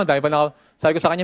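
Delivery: ordinary talk, nothing marked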